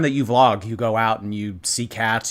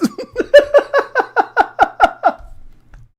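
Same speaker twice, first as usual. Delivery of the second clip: high squealing laugh, louder; 7 dB louder than usual. Real, not acted. Streamer laughing at blurry picture, high squeal.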